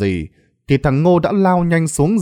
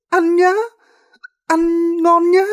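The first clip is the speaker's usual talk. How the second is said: laughing madwoman. A narrator's reading. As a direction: taunt gleefully in a demented high voice. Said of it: "miệng thì cười lớn". (giọng bà điên cười cợt, the thé)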